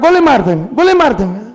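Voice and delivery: lightly hammed street-punk voice; mock rowdy threat. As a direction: imitate a hothead youth shouting threats in jest. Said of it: "उसमें हल्ला गुल्ली करके कहता है".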